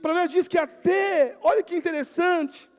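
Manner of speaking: loud, high preacher's declamation; full-force preaching. Acted. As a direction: proclaim the proverb to the congregation.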